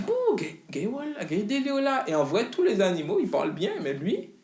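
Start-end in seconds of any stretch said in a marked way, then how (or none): 0.0-2.0 put-on high, swooping take on an Antillean-African accent; mimicked Antillean-African accent. Father mimicking the dubbed Lion King monkey, mock Antillean-African accent. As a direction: imitate the cartoon monkey's mixed Antillean-African accent, pitch jumping up. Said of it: "une espèce de mélange d'accent, euh, antillais, africain".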